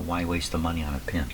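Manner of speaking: ordinary talk, nothing marked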